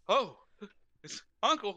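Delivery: high, loud hammed-up surprise; surprised greeting. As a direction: greet a relative with mock surprise. Acting out greeting the uncle.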